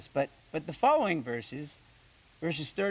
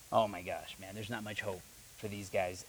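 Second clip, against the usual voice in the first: lower, softer weary sigh of a line; eye-rolling exasperation; 8 semitones lower, 7 dB quieter than usual. Retold or imitated. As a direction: mutter it with a roll of the eyes. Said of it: "he rolled his eyes like".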